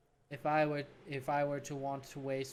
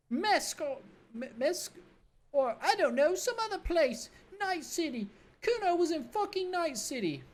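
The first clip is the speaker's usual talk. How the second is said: put-on bratty kid Kuno; 13 semitones higher than usual. Cocky kid mocking the cop, in a high bratty voice. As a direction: sneer it like a cocky street kid.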